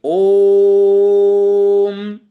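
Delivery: ओम held very long and loud on one steady note; loud sustained ओम; prolated three-beat vowel. Shown as a demonstration. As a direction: chant ओम, stretching the vowel into a closing hum. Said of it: "प्लुत इसका उच्चारण त्रिमात्रिक होना चाहिए".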